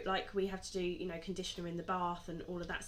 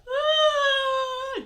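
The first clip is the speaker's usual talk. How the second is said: high, loud wail of dismay; a loud anguished wail over the cut curls; anguished cry; 16 dB louder than usual. Retold or imitated. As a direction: cry out as if dying inside. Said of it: "I'm just like".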